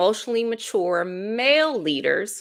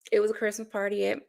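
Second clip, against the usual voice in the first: ordinary talk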